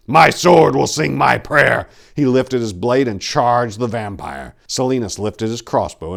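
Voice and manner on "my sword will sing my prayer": a loud, slow, defiant promise; loud slow vow. A narrator's reading. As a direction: swear it as you charge. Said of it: "he promised".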